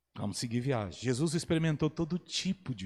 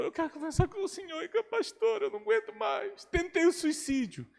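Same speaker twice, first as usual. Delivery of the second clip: put-on despairing young girl; 13 semitones higher than usual. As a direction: voice the broken girl pleading for help.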